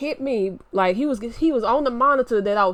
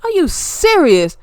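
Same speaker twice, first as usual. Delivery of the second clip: high, loud and slow; raised voice; 7 semitones higher, 7 dB louder, slower than usual. Real, not acted. Outraged at the cousin's choice, loud and slow. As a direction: demand an answer, outraged. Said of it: "I don't mean to raise my voice".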